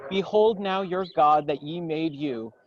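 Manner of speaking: ordinary talk, nothing marked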